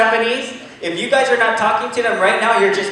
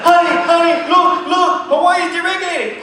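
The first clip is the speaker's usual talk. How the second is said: high, loud excited shout; 9 semitones higher, 5 dB louder than usual. Retold or imitated. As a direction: yell to your spouse in disbelief. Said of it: "You gonna be like".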